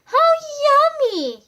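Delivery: high, slow delighted squirrel voice. A narrator's reading. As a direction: savor it in a high, slow squeak. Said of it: "he said".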